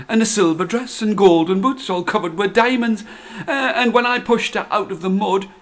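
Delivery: put-on breathless old man spilling his news; excited old man. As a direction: gabble it out like an overjoyed old husband. Husband excitedly telling wife news.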